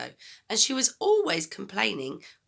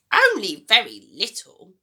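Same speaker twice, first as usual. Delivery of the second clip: grumpy grandma voice; 11 dB louder than usual. Acted. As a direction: nag the boy like a sour old granny. Grandma answers.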